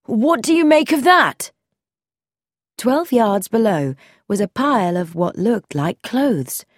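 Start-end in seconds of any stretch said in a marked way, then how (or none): 0.1-1.5 high, loud alarmed question. A narrator's reading. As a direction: point out the shock below. Spotting a body below.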